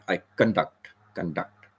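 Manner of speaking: soft, reduced "con" in "conduct"; stress on DUCT. Shown as a demonstration. Teacher repeats the verb, "conduct" with weight on DUCT. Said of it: "C O N is soft".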